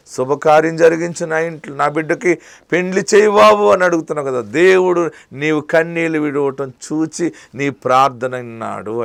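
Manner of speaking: loud, high, impassioned preaching; fiery preaching. Acted. Preacher promising weeping believers healing, loud and fervent. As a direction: preach it out to the crowd with full force.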